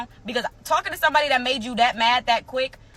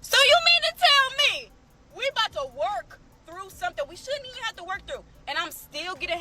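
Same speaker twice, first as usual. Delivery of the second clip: exasperated disbelief; 7 semitones higher than usual. Real, not acted. Outraged at the boyfriend's text.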